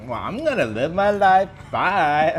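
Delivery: high mock-breezy farewell; mocking sassy. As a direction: play up the carefree brush-off.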